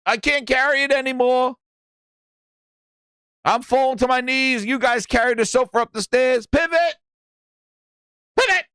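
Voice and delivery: high, strained, frantic mock yell of Pivot; Xbox pivoting. Xbox voiced as a mover dropping the sofa, frantic high yell. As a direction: squeal Pivot like a panicked mover losing the sofa. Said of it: "Xbox is like".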